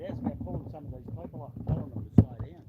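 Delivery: furious full-volume yell; angry shout. Retold or imitated. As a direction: bellow it at him, fed up. Retold yelling at the caller.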